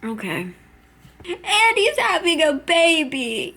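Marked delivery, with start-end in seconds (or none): 1.2-3.5 slow, loud and high announcement; stunned exclaim. Real, not acted. Viewer shouts the twist at the screen, stunned. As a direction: blurt it out, thunderstruck.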